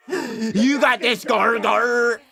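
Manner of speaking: slow pirate-tinged cheer; pirate-ish cheer. Shown as a demonstration. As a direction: drag it out like a pirate. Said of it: "Why are you going pirate?".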